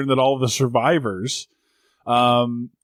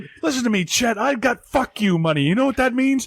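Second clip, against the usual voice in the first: ranting anchor; 9 semitones higher than usual. Retold or imitated.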